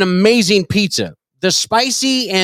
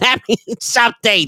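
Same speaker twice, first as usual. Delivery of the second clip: mock gang member; 6 semitones higher than usual. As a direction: draw it out high in a hammed-up character voice.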